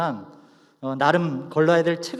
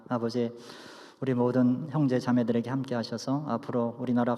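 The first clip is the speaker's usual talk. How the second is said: hushed, low benediction prayer; 4 semitones lower, 5 dB quieter than usual. Acted.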